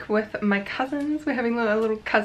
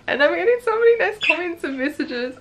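excited; 8 semitones higher, 5 dB louder than usual. Real, not acted. Launch day excitement.

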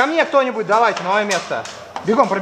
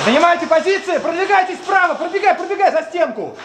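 raised, urgent team commands; shouted orders; 6 semitones higher than usual. Real, not acted. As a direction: bark orders to the squad. Calling orders in a shootout.

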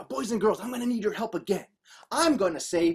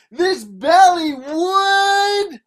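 louder, high, held last word; building suspense; 9 semitones higher, 9 dB louder, much slower than usual. A narrator's reading. Belly about to burst, drawn-out loud suspense. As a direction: swell it and leave it hanging.

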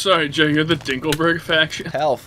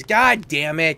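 ordinary talk, nothing marked

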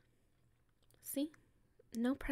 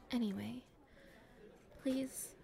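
usual speaking voice

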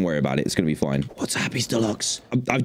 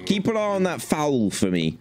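normal talking voice

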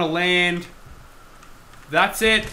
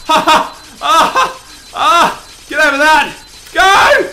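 loud high yells, laughing; excited screaming; 10 semitones higher, 11 dB louder, slower than usual. Real, not acted. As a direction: scream the ball on. Screaming at hectic level.

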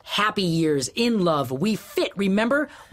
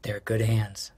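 soft, low whispered reply; 8 semitones lower, 4 dB quieter, slower than usual. Acted. Husband quietly answering his wife's memories, whispered. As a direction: let it barely come out.

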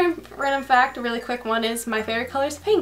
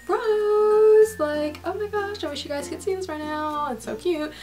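gushing; 8 semitones higher than usual. Real, not acted. Gushing over cute product.

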